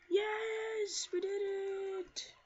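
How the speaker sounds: high, loud, drawn-out victory shout; triumphant cheer. Real, not acted. Player after winning the boss fight, triumphant cheer. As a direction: stretch it out in high-pitched triumph. Wins the hard battle.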